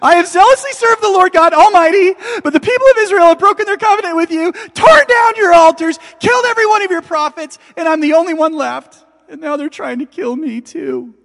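very high, loud, rushed desperate outcry; frantic repeated cry. Acted. Elijah repeating his frantic complaint to God. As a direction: shout it over and over, unable to stop.

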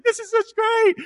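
put-on stoked kid brother. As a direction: squeak it out like a thrilled little brother.

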